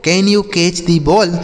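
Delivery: slow, careful English question; slow model reading. Shown as a demonstration. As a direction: read it out slowly for learners. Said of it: "slow model sentence repeated".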